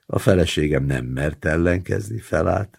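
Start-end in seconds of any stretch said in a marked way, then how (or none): none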